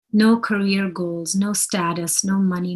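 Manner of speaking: ordinary delivery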